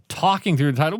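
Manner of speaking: ordinary talk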